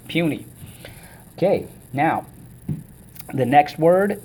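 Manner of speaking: ordinary delivery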